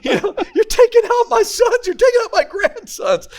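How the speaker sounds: high, laughing mock alarm; high laughing imitation of an alarmed mom; mock scolding mom. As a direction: play the shocked mom, half laughing. Mom scolding grandpa's roughhousing.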